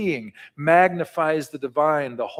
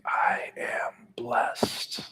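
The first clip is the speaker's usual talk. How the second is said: slow whispered affirmation; 3 semitones lower, 7 dB quieter, much slower than usual. Shown as a demonstration. Pastor leading the congregation in a whisper. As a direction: breathe it out softly. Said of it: "Can you whisper it with your mouth and your breath?".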